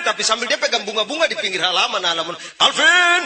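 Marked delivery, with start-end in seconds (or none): 2.5-3.2 high, loud wailed name; hammed-up grieving shout; crying scream. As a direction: call out as a theatrical mourner. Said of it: "dia berteriak".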